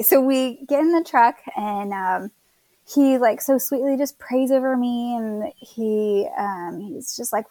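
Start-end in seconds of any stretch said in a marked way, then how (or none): none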